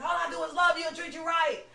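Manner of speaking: high, loud shout at her brother; retold shouting. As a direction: yell it like you've lost your voice doing it. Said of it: "I didn't have no voice".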